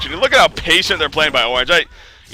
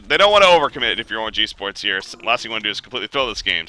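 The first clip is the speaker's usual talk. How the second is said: usual speaking voice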